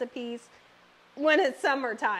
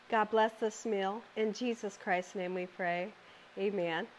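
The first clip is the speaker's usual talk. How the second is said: soft, hushed grace over the meal; hushed mealtime prayer; quiet prayer; 5 semitones lower, 7 dB quieter than usual. Real, not acted. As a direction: bless the food gently, dropping low. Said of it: "I'm gonna pray".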